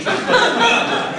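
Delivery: giggling through the words; laughing. Real, not acted. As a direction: barely hold it together.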